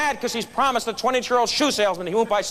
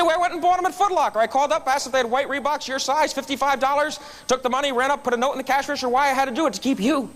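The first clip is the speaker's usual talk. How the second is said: put-on eager young salesman; 7 semitones higher than usual. Chip explains buying the shoes.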